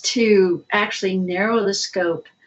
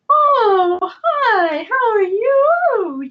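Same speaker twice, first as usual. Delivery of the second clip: put-on very high, stretched-out baby talk; sing-song motherese; 13 semitones higher, much slower than usual. Shown as a demonstration. Speaker demonstrates how parents greet babies, sing-song motherese. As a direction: coo it in a high sing-song, drawing out each vowel. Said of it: "And that's that sing song".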